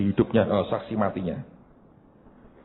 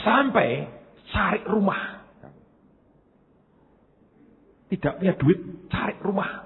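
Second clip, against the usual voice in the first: emphatic disbelief; 10 semitones higher than usual. Real, not acted. Emphatic disbelief at own folly.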